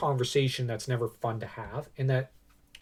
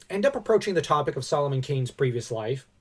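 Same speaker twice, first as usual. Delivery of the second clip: normal talking voice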